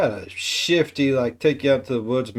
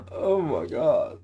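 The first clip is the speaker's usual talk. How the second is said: slow gasped oh my god, a touch softer; gasping surprise; 4 semitones higher, 4 dB quieter, slower than usual. Real, not acted. Reacting to the killer's motive, gasping surprise. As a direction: gasp in dawning shock. Gasp at realization.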